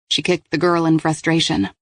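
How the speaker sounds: ordinary delivery, nothing marked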